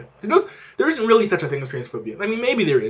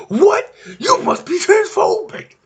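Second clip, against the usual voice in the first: much higher, louder squeaky mock outrage at "What?"; mocking outraged critic; 5 dB louder, slower than usual. Mocking people who call him transphobic, high squeaky outrage. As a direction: parody a shocked online critic.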